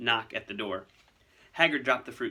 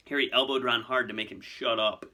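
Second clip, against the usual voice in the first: plain reading voice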